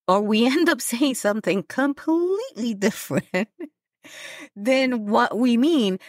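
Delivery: laughing through the words; words bubbling with real laughter. Real, not acted. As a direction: giggle as you confess it. Laughing at her own point.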